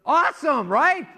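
high, loud burst of enthusiasm; thrilled, prodding the audience to agree; excited. Real, not acted. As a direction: pitch it way up to rouse the crowd. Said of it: "You gotta get excited".

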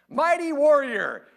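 high, loud and slow, mocking the title. Angel mocking a scared Gideon, loud and slow. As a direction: sneer the title at a coward. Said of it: "the angel's mocking Gideon".

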